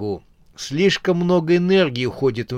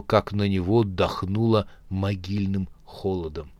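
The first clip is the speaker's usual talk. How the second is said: plain reading voice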